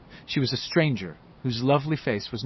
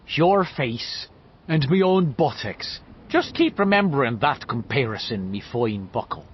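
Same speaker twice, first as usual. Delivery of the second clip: Irish cop voice; 2 semitones higher, 3 dB louder than usual. A narrator's reading. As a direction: keep up the Irish beat-cop bit. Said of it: "in the Irish cop's voice".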